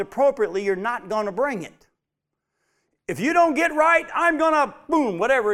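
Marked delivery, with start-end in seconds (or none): 3.1-5.5 put-on high, loud warning to a child; parental threat. Parent warns a child to get right, threatening. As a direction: lay down a stern parental threat.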